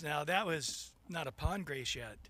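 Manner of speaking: low, soft, understated voice of God; smiling Lord. Acted. God smiles at the swamped man, low and gentle. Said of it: "the Lord smiles and says".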